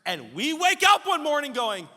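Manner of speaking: high, shouted preacher's cadence; full-force preaching. Real, not acted. Preacher telling the congregation God corrects our ways, full-force preaching. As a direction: shout each phrase home to the congregation.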